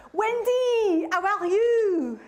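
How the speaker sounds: put-on high, loud gushing greeting from a French teen; excited French friend. French pen friend greeting Wendy at the door, high excited squeal. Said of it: "It was my French pen friend, Karine".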